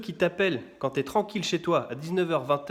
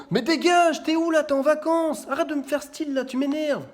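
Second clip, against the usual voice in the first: high, loud exasperated scolding; angry parent; 12 semitones higher, 5 dB louder than usual. Retold or imitated. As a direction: snap at the kids.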